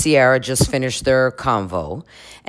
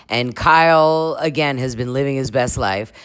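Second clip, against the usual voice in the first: normal talking voice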